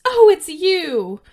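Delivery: high, loud, drawn-out shocked scream. Retold or imitated. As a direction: shriek it in horrified recognition. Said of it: "and then screams".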